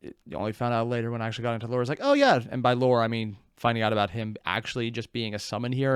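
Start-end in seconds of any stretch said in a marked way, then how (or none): 2.0-2.4 mock realization.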